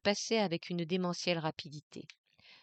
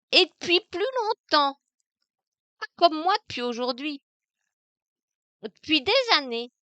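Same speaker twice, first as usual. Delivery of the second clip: loud, higher, cheeky taunting; the maid jeers at her masters, louder and higher; insolent, mocking; 4 semitones higher, 6 dB louder than usual. Maid taunting her masters, insolent and loud. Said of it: "L'insolente et gouailleuse voix, toute vibrante".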